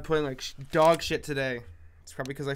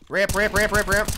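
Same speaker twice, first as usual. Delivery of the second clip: loud, higher repeated call; urgent callout; 6 semitones higher, 8 dB louder than usual. Real, not acted. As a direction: shout the warning fast.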